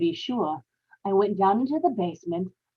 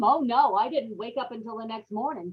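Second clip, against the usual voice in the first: ordinary talk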